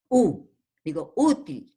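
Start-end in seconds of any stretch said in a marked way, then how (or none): 1.2-1.7 "útil" with the accent on the opening ú; stress on first syllable. Shown as a demonstration. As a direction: hit the ú of "útil", then let the til fall. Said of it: "la acentuación en la U". ("útil" con el acento en la ú)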